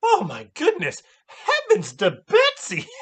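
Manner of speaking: high, loud hammed-up church-lady cry; exaggerated, laughing prim exclamation; mock prim shriek. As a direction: squeal it like a scandalized old lady. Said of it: "She's all sitting there going".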